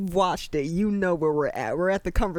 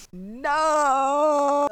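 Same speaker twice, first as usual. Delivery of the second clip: high loud wail of no; anguished no; 8 semitones higher, 5 dB louder than usual. Real, not acted. Viewer watching the couple break up, loud high wail. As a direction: cry out in protest.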